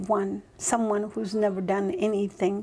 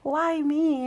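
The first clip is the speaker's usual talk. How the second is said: humbled awe; 6 semitones higher than usual. Retold or imitated. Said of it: "but I'm like".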